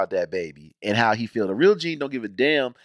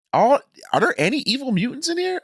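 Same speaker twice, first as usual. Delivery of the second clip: mock disbelief; 13 semitones higher than usual. Real, not acted. Mock-wondering aloud about the show.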